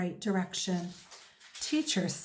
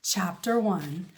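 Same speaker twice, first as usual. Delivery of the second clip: plain reading voice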